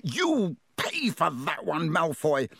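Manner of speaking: slow, furious yell. A narrator's reading. Ron yelling at Malfoy, wand drawn, furious. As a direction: shout the threat as you draw the wand.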